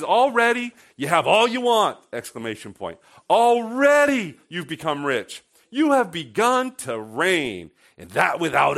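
loud, high, slowed mock praise; sarcastic. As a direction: heap on the fake admiration. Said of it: "He's being very sarcastic".

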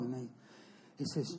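ordinary talk, nothing marked

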